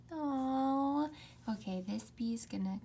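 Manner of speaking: ordinary talk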